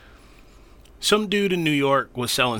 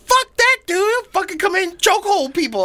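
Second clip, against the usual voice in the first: much higher, louder "Fuck that dude"; outraged shout; 4 dB louder than usual. Real, not acted. Friend refusing to welcome the cop, loud outraged shout. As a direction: blurt it out in disgust.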